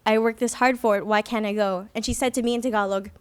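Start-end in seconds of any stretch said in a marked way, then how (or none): none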